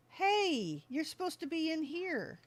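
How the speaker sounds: ordinary talk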